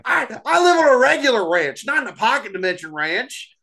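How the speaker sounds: high goofy country voice; silly cowpoke. Pretend. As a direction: play a dopey ranch hand.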